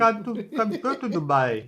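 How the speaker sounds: mock Indian accent. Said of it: "Indian accent".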